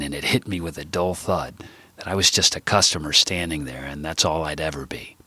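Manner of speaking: usual speaking voice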